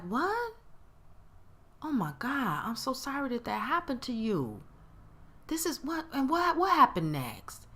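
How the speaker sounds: soft, concerned shock; genuinely sympathetic. Acted. Caring friend hears what happened to you, sympathetic. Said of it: "Different tone".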